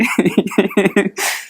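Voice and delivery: amused chuckling; laugh. Real, not acted. Preacher amused at saying the wrong word, laughing. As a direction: chuckle at a possible slip of the tongue.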